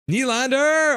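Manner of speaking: high, loud burst of a name; thrilled cry at the screen; excited shout. Real, not acted. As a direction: yell the name out in sudden excitement. Excited reaction to player on screen.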